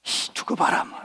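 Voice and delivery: very soft, voiceless sulky grumble; sulky grumbling. Acted. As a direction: grumble sullenly under your breath. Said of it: "먹으면서 뚱 해가지고". (뚱하게 작은 소리로 투덜대듯)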